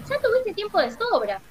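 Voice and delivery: a touch of irritation at the wizard; annoyed. A narrator's reading. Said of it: "enojó el hombre de hojalata".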